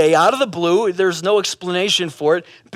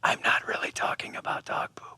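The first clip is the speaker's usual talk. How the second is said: hushed stage whisper; whispered secret; 12 dB quieter than usual. Pretend. Preacher confiding the parable's secret to listeners, stage whisper. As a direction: let them in on it in a whisper. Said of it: "Here's a secret".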